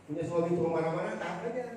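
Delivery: usual speaking voice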